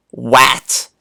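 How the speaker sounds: Wat said with a short A as in cat; wrong short A. Shown as a demonstration. Teacher showing kids the wrong reading, short A. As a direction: clip Wat with a flat short A vowel. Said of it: "We don't say wat".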